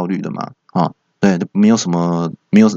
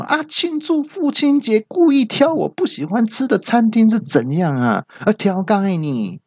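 high indignant outburst, pitched way up; yelling; 13 semitones higher than usual. Retold or imitated. Friend yelling at the narrator, high and indignant. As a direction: shriek it out in mock outrage. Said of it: "那我朋友就大叫说".

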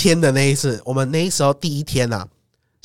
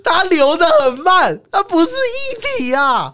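incredulous; 13 semitones higher, slower than usual. Real, not acted. Outraged retelling of friend's logic.

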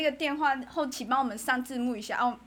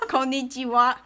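laughing; 4 dB louder than usual. Real, not acted. Laughing through fake Japanese.

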